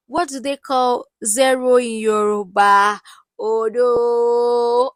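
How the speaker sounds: mock shade. Vlogger retelling the doctor's shade, loud mocking quiz. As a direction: throw the question like a jab. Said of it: "Doctor Ramel now shades that".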